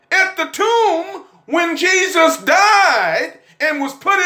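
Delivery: high, ringing preacher's cry; whooping sermon, slow and high; preaching at full force. Acted. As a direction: lift the church with it. Preaching climax.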